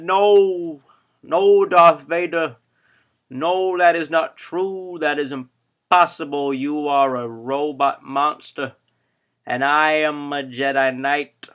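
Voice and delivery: hammed-up horrified denial, slow and emphatic; Luke. Hero refusing Vader's claim, hammed-up loud denial. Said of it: "Luke made a face like this".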